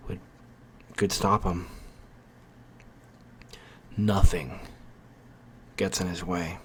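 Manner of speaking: portentous storyteller, letting each phrase hang; ominous narration. A narrator's reading. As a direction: intone it like a foreboding prophecy.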